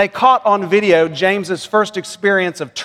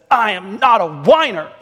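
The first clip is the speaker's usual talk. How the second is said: put-on whiny little boy; 3 semitones higher than usual. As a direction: imitate a small kid whining in protest. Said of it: "said it in a whiny voice".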